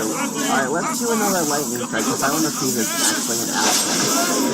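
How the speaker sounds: ordinary talk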